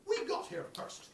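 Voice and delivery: high whispery pig; a lightly voiced piggy boast; high, breathy pig claiming first. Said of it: "And the pig said".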